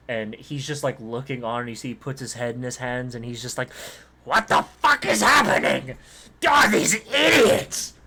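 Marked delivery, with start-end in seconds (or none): 4.2-6.0 high, loud, slow furious screech; screaming halfling. Acted. Halfling boss screaming at workers over the fire, furious high shout. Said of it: "he's, like, just screaming". 6.5-7.9 high, loud, slow furious screech; screaming halfling. Acted. Halfling boss screaming at workers over the fire, furious high shout. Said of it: "just screaming".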